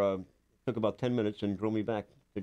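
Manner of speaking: ordinary talk, nothing marked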